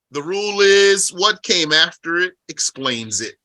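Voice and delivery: usual speaking voice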